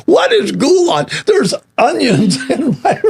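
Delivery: put-on high, slow whining child voice; whiny kid. Kid complaining to mom about onions in goulash. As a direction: drag it out like a disgusted kid.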